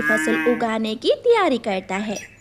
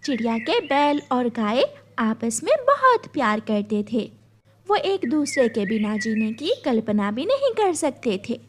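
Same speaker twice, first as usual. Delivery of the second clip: ordinary talk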